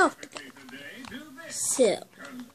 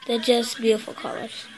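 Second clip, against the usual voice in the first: normal talking voice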